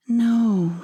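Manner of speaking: usual speaking voice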